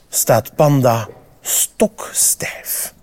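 slow, hushed whisper as Panda freezes; suspense whisper. A narrator's reading. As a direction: breathe the words out slowly, holding the tension.

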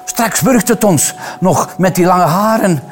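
high, agitated warning from the wife; panicked shrieking. A narrator's reading. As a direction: plead in alarm, pitch up high. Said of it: "de vrouw sprong gillend opzij". (hoge, paniekerige waarschuwing)

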